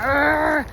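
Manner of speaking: a mock grumbly growl at passers-by; imitating dog grumble. Imitating the dog grumbling at walkers, mock growl. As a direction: do the dog's grumbling "Arr!". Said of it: "He's like".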